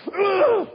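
high, loud strangled ugh; a weak, squeaky attempt at yelling; mock feeble yell; wife's feeble yell. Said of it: "she just went".

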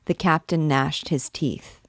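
ordinary delivery

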